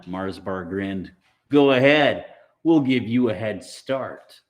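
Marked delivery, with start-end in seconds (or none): 1.5-4.4 loud mocking dare; taunting. Mars Bar dares Maniac to run, loud mocking taunt.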